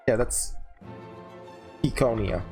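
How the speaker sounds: ordinary talk, nothing marked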